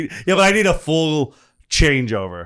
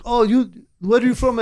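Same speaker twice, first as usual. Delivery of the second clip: light mock Russian accent, pitched higher; a hint of a Russian fan asking, higher up; Russian audience member; 9 semitones higher than usual. A Russian audience member quizzing the comic, in a light mock Russian accent. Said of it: "this Russian guy was in the audience and was like".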